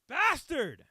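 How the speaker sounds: high loud burst of fake fury; mock outraged yell. Cursing the old man's secret plan, hammed-up yell. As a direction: spit out the insult, hammed up. Comic outburst at the old man.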